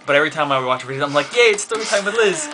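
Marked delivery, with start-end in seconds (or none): 1.3-2.5 high, delighted mock cheer; excited cheer. Fan cheering as a new story time video starts, excited. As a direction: cheer it in a higher voice.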